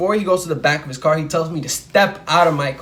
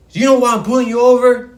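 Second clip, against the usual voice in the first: loud, high shouted question; retold cop shouting at the pulled-over kid; angry cop yell; 7 semitones higher, 6 dB louder, faster than usual. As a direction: bark it at the driver like an angry cop. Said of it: "he's screaming at me".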